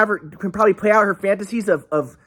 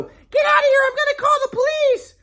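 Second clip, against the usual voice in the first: shrill, much higher mock yell from behind a window; mock yelling mom. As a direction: squeal it like an angry mom shooing him off. Ex's mom yelling through window.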